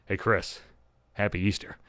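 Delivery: low, soft mock approach; imitated tentative greeting. Boyfriend coming up later at Easter, quiet and tentative.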